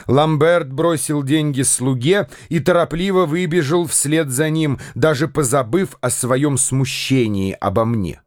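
plain reading voice

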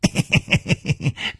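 high, rapid sly cackle; villain's cackle. Acted. Bai Yumo answers his chief, high cackling laugh. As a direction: cackle in a quick stuttering run. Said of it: "白玉魔咯咯的笑着说".

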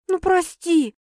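high, pleading apology. A narrator's reading. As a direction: beg forgiveness, voice up high. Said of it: "умоляюще произнесла девушка". (умоляюще, высоким голосом)

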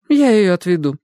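volunteer brightly; eager old lady. A narrator's reading. Adelaide voiced by narrator.

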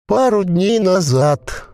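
high, slow gurgling creature voice; a possessed corpse bubbling out its words. A narrator's reading. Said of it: "утробно возвестил Клек".

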